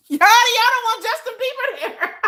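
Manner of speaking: laughing high, teasing the chat. Real, not acted. Streamer teasing her chat about the show, laughing. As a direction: giggle through the words at the viewers.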